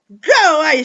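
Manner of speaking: loud, furious shout of go away; angry shout. Acted. Angry boy shouting at the superpower to go away. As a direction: yell it to push them off.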